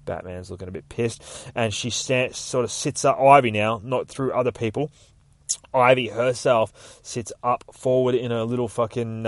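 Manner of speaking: ordinary delivery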